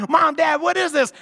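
high, loud startled asking; mock teen bewilderment, pitched high; bewildered question to parents. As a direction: call out to the parents in confusion. Teen asks parents about photo.

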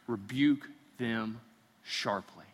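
slow, soft, weighty reading of "rebuke them sharply"; grave scripture reading, quiet and slow. Shown as a demonstration. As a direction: let "rebuke them sharply" sink in slowly and softly. Reading verse, then repeating it.

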